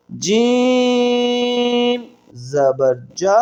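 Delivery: slow, chanted letter-by-letter reading; drill recitation. Acted. Teacher leads the class through a slow, chanted syllable drill. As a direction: recite each letter and vowel slowly for students to repeat.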